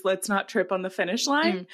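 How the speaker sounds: ordinary talk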